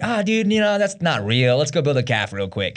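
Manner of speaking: high, hammed-up dude brushing it off; imitating a flippant doubter; mock dismissive. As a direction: start high and loud, then drop off casually. Voicing Israelites dismissing what they saw.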